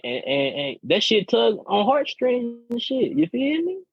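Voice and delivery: high, pleading, choked-up voice; mock emotional plea. A man pleading with his woman, mock tearful. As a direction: ham up being on the verge of tears. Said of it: "I'm not getting emotional, y'all".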